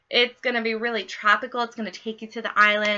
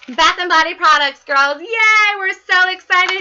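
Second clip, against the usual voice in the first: excited cheer; 7 semitones higher, 7 dB louder than usual. Real, not acted. Host hyping her sales team, bright excited cheer. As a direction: cheer the products on like a party host.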